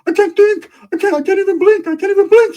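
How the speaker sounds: squeaky high whine; a goofy high whimpering Ricky; dazed Ricky. Retold or imitated. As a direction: whine it in a tiny high voice like your head's caved in. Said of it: "Ricky's like".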